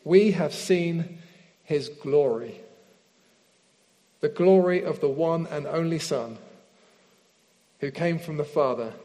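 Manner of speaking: slow, measured Bible reading with long pauses; slow Scripture reading. Real, not acted. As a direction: read it through slowly, phrase by phrase, letting each rest. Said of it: "I'm gonna read this through slowly".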